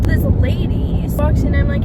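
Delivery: ordinary delivery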